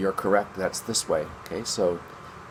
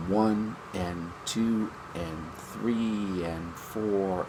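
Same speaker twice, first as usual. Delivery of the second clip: very slow, soft, drawn-out count; 6 dB quieter, much slower than usual. Shown as a demonstration. Teacher repeats the count for the class, very slow and soft. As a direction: count it out slowly. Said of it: "So once again".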